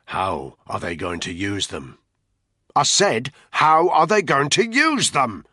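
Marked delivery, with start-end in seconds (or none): 2.7-5.5 loud, high, drawn-out shout; angry repeated demand. Acted. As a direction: shout the question with slow, forceful words. Interrogator repeats question angrily.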